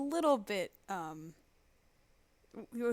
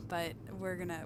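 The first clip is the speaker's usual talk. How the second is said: usual speaking voice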